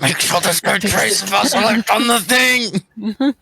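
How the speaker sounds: high, drawn-out comic urgency; mock inner panic. Acting out an urgent inner thought.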